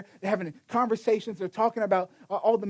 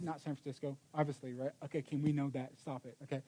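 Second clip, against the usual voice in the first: teasing aside; 6 semitones lower, 12 dB quieter than usual. Real, not acted.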